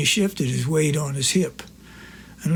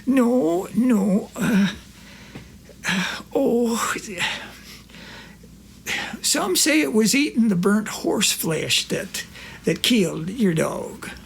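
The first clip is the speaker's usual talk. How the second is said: higher, lightly voiced old country friend; Corky's voice; 5 semitones higher, slower than usual. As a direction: play the innocent old pal.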